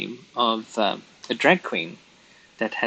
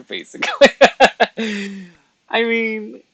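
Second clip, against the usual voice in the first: cracking up mid-sentence; high-pitched, laughing aside; 11 semitones higher, 8 dB louder than usual. Real, not acted. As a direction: break into high, loud laughter. Cracks up at own story.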